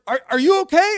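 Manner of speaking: high, loud, panicky question; alarmed check. Retold or imitated. Boy to friend after falling through ceiling, loud alarm. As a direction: call it out as if just crash-landed.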